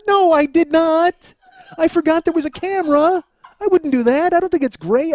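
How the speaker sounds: high, protesting comic whine of innocence; indignant denial. Retold or imitated. Man defends himself to friends over the tape, indignant denial.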